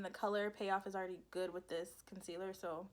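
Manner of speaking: ordinary delivery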